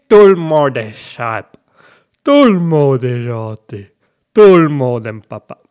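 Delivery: slow put-on voice, each line falling from a punched first word; mimicked catchphrase. Host quoting a reference only old-timers know, slow mimicked voice.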